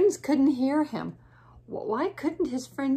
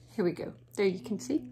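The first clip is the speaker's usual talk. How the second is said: ordinary delivery, nothing marked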